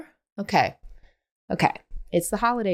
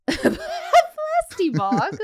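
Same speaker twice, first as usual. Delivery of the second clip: high, slow, laughing echo of the words; laughing disbelief; much slower than usual. Real, not acted. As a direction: crack up as you repeat it. Echoing silly British term.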